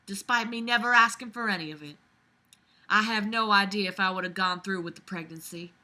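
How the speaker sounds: ordinary talk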